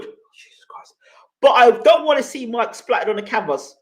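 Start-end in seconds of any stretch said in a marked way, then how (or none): 0.0-0.9 a whispered aside of despair; hushed Jesus Christ under the breath; exasperated whisper. Real, not acted. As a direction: breathe it out, barely voiced, in disbelief. Exasperated at a viewer's comment.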